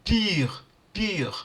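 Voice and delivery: "pure" with a rounded French u, close to pi. Shown as a demonstration. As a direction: tight rounded lips on "pure". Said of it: "the real sound in French like pi".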